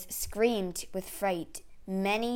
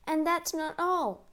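higher-pitched, urgent exclamation; urgent cry; 8 semitones higher than usual. A narrator's reading. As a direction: cry it out with more bad news to come. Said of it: "cried the secretary".

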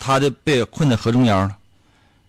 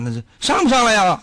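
normal talking voice

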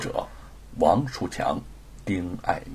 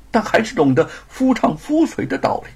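ordinary delivery, nothing marked

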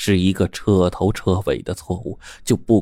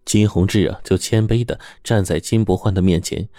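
usual speaking voice

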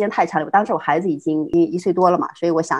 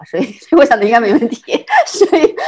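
laughing; 5 semitones higher, 6 dB louder than usual. Real, not acted. Laughs recalling confidence.

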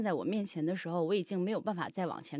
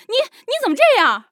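very high, loud, fast protest; hammed-up high stammering reproach; imitated slapped girlfriend; 14 semitones higher, 14 dB louder, much faster than usual. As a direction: mimic a stunned girlfriend's outraged protest. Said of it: "他女朋友捂着他的脸看着我说".

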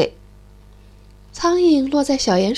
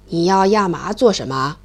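put-on low, gruff old stork; 6 semitones lower than usual. The stork grumbles low at the noisy mole. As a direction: grumble low like a big bird.

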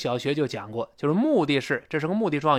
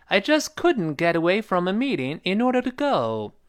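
slightly faster; 4 semitones higher than usual. Shown as a demonstration. Teacher reading the sentence once more, a bit faster. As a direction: model sentence at a brisker pace, higher pitch. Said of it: "语速再稍微快点".

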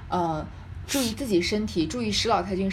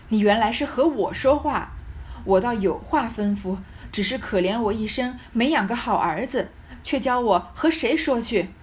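loud opening, stern and emphatic; forceful, deliberate dressing-down, read dramatically; stern reproach; 5 dB louder than usual. Acted. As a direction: scold a son with bitter reproach. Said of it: "厉声说道".

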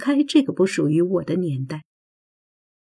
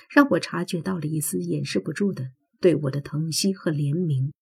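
ordinary delivery

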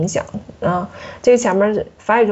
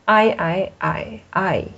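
"I I I I" slowly through tones one to four; four Mandarin tones; much slower than usual. Shown as a demonstration. Teacher demonstrates pinyin tones on "I", ending in fourth tone. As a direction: step "I" from level to rising, dipping, then falling. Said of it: "汉语拼音中的一二三四第四音".

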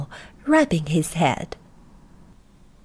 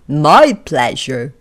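lower, louder postman voice; postman's voice; 5 semitones lower, 7 dB louder, slower than usual. A narrator's reading. Postman greeting a tiny man, louder and lower. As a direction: boom it down like a big postman.

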